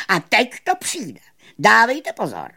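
high, loud announcer's build-up; showman magician. Acted. Magician announcing his next trick, high and loud. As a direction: call the audience to attention.

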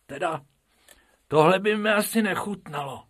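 high, quick little rabbit voice; a small rabbit pulling a face; Bobek's voice. Acted. Rabbit Bobek to Bob at the lion cage, high and quick.

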